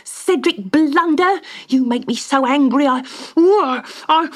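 high, loud, furious nagging wife; angry scolding. Acted. Wife Magda furiously scolding Cedric for his foolishness. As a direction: berate him at full volume.

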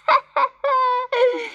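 high, cackling bird laugh; kookaburra laugh. A narrator's reading. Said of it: "laughed softly".

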